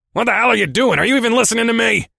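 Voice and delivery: very high, loud, exasperated shouting; angry growl. A narrator's reading. Boss yells at his driver for not listening, furious growl. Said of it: "Marsh growled again".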